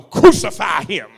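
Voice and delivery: loud, slow crowd cry; imitated mob shout. Preacher voicing the crowd turning on Jesus, mock shout. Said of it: "They were yelling".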